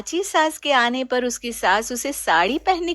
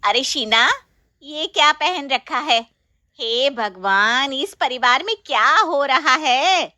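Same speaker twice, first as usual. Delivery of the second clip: louder, scandalised aunt; shocked outcry; 2 semitones higher, 4 dB louder than usual. Acted. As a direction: cry out in shock. Chachi shocked by the outfit. (चाची हैरान होकर ज़ोर से चिल्लाती)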